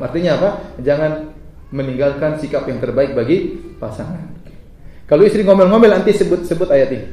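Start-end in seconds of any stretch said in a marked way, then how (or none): none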